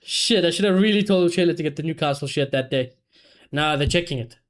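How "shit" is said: ordinary delivery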